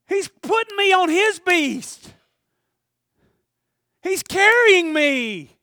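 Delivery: high, loud crying shout, slowing; tearful shout. Acted. Rescued man crying out in gratitude. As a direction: cry out in disbelief.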